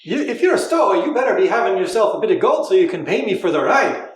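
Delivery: put-on a light Irish accent, high and laughing; Irish accent, amused. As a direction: play a gruff Irish ferryman. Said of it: "You do the Irish voice".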